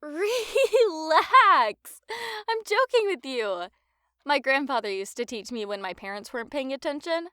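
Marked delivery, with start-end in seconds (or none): 0.0-3.7 laughing, high and loud. Acted. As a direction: laugh it off, teasing him. Teasing after fake offense.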